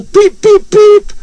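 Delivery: high, loud whistle beeps; train whistle. A narrator's reading. Engine signals the guard, high loud whistle. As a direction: pipe three shrieking whistle notes.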